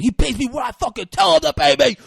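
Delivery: loud, high acted outburst; angry retort. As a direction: shout it like an indignant gangster. Acted quarrel between film gangsters.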